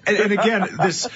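usual speaking voice

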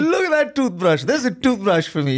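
high, delighted marvel at a small luxury; starry-eyed gush; giddy wonder. Retold or imitated. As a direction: coo over the toothbrush like a treasure. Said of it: "I was just like".

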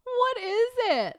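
high, drawn-out cracking-up question; laughing disbelief. Real, not acted. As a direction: squeal it slowly through laughter.

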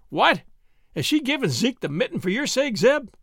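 put-on high, piping boyish voice; little Hiram piping up to tease Zeb; piped. A narrator's reading. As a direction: pipe it up high like a small lad. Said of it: "piped little Hiram".

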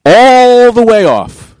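ALL stretched long, high and loud; drawn-out emphasis. Real, not acted. Confirming his pants came all the way off, drawn-out high loud All.

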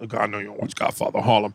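quick, low, soft presumption; dismissive. Real, not acted. Assuming a cohost doesn't watch the show, dismissive. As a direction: toss it off fast and low, dismissively. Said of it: "why would you say it like that?".

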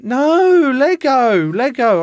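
very high, loud mock wail; loud drawn-out toddler bawling; imitated crying toddler. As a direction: howl it like an upset two-year-old. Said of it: "he's still, like, going".

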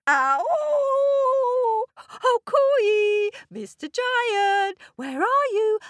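high, loud sing-song call. Acted. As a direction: call into the giant's throat. Coyote calls down the giant's throat.

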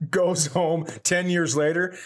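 ordinary delivery

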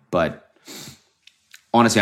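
ordinary talk

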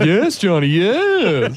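humouring yes; nodding along. Pretend. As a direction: pretend you understand every word. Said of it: "We're like".

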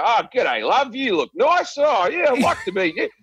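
clumsy Australian chat-up line; broad Australian accent. Acted. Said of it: "But my, my accent".

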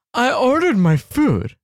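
mock an entitled diner griping; whiny customer. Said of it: "people are like".

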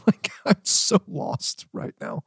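retold bewilderment broken up by laughter; laughing. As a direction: laugh helplessly while quoting yourself. Laughing at own bewilderment in story.

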